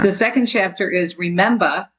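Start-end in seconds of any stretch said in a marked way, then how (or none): 1.2-1.9 REMEMBER as "rememba", Jersey style; Jersey REMEMBER, final R dropped; Jersey accent. Shown as a demonstration. Author reading her mother's saying, Jersey accent. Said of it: "not remember, but remember".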